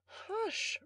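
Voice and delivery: high, soft hushing; quiet him gently in a light female voice; sweet young lady. A narrator's reading. Young lady quelling the noisy butler, soft high hush. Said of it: "a sweet female voice".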